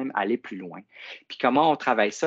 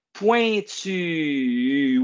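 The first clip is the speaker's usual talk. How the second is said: Pointu drawn out, higher, U held; slow syllables; 4 semitones higher than usual. Shown as a demonstration. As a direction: stretch out Pointu, holding the final U. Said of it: "Dis-le avec moi". (Pointu allongé, le U final tenu)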